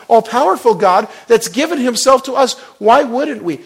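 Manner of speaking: high, loud fiery sermon delivery; full-force preaching. Acted. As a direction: preach it out, building to a roar.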